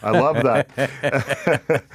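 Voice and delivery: laughing through the words, slowed right down; delighted laugh as you say it. Real, not acted. Host loving the ad clip, laughing warmly.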